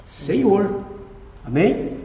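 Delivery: ordinary talk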